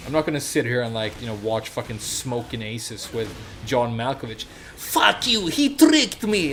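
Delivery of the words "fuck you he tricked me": mocking a hammy Russian accent, higher, louder and slow; bad Russian accent. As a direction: do a cheesy movie-villain Russian accent, pitched up. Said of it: "doing his, like, shitty fucking Russian accent".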